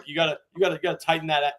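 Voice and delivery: usual speaking voice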